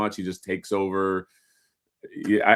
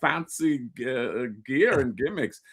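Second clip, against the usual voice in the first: higher-pitched mock Middle Eastern accent; slow, halting wrestler-style accent; Iron Sheik; 4 semitones higher, slower than usual. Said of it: "sounds like Iron Cheek".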